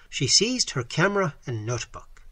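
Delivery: plain reading voice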